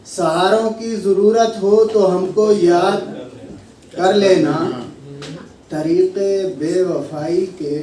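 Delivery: measured mushaira recital with weighted phrases; Urdu poetry recitation. Acted. Poet opening the gathering with a couplet, recited. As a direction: recite in phrases, pausing for the audience's response.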